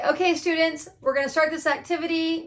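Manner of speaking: bright teacher addressing class. Shown as a demonstration. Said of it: "let's act like I'm the teacher".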